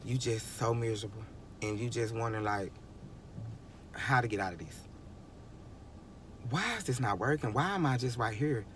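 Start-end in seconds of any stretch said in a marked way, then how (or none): none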